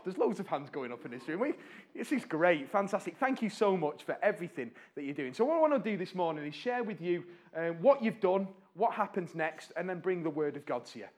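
ordinary talk

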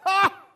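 big burst of real laughter; loud, high ha of amusement. Real, not acted. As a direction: let a hearty laugh out.

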